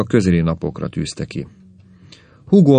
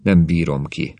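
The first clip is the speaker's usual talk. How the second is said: ordinary delivery, nothing marked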